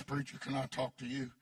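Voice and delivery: a hint of a low, rough woman's voice; imitating her low voice; her low way of talking. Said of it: "she talked like this".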